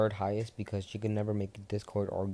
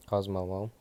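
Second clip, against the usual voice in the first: usual speaking voice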